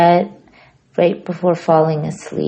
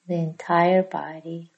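hushed yoga nidra guide, long pauses; soft, unhurried relaxation voice; guided meditation; 4 dB quieter than usual. Real, not acted. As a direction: ease them into stillness, growing softer toward near-whisper.

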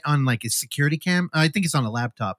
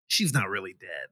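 hushed aside; 9 semitones lower, 8 dB quieter than usual. Retold or imitated. Revealing the prank as an aside.

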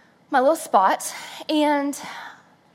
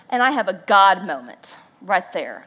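ordinary talk, nothing marked

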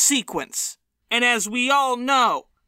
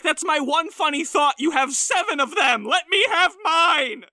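higher, pleading to a rival comic; mock whiny plea; 7 semitones higher than usual. Comic begging John Mulaney to leave him his one joke, mock whine. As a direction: whine like a robbed comedian, half-laughing. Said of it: "It's like".

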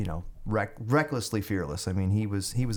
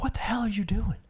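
hissed, much higher furious major; angry major; 13 semitones higher than usual. Retold or imitated. Major pulling the soldier over, hissed fury. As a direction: hiss it at the soldier you caught.

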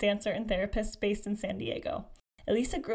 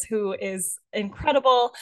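ordinary talk, nothing marked

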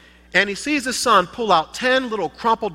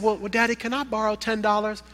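put-on timid boy asking; 5 semitones higher, 4 dB quieter than usual. Boy asks to borrow.

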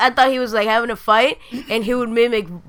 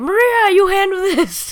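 panicked plea; 9 semitones higher, 3 dB louder, slower than usual. Retold or imitated. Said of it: "he's like".

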